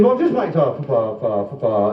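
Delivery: comic stutter in a long run; mock stammer running down; stammering customer.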